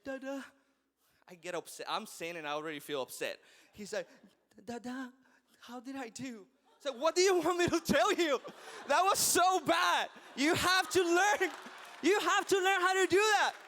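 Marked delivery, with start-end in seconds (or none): 0.0-0.4 put-on small, higher little-boy voice; understated mock whimper; crying child, imitated. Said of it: "he comes crying". 4.7-6.4 put-on soft, high little-boy voice; mock-tearful child seeking approval; crying child, imitated. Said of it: "he comes crying". 7.0-13.5 high, shouted dad rant; mock-furious father at full volume; exasperated scolding. Acted exasperated dad scolding son.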